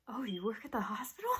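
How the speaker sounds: understated, taken-aback question; surprised nurse. Retold or imitated. Nurse hearing patient works at hospital, understated surprise. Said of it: "her eyes went like".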